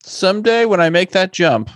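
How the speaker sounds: high-pitched mock inner thought; high, playfully voiced self-quote; imitating own musing. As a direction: lift the voice high, voicing your own thought. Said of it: "I kept looking and thinking".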